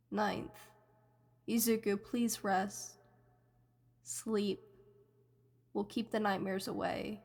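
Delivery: hushed and soft, close in his head; barely above a breath, soothing; soft whisper-like voice. A narrator's reading. Voice in his head soothing sleeping Izuku, hushed. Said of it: "heard someone whispering something".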